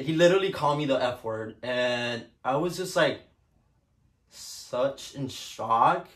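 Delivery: ordinary talk, nothing marked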